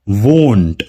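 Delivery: won't said slowly with a long o vowel. Shown as a demonstration. Teacher to students, slow won't demo. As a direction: draw out the o in won't. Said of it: "जिसमें ओ का pronunciation ओ होगा".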